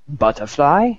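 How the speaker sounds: scornful German query, rising sharply at the end; fuming German. Acted. German guy challenging the others' words, mock fuming German accent. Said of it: "the German sits there for a second fuming".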